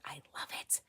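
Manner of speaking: soft unvoiced whisper. Real, not acted. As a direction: breathe it like a secret. Whispered aside about the book.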